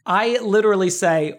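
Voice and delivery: ordinary delivery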